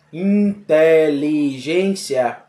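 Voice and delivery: "Inteligência" stretched slowly, syllable by syllable; sounded out slowly. Shown as a demonstration. Teacher to class, dragging the word out very slowly. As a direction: draw out "Inteligência", each syllable separated. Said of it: "quantas sílabas tem na palavra".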